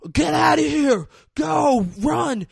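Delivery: high, loud urgent yell to flee; desperate shout. Retold or imitated. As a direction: scream at them to run. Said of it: "he's like".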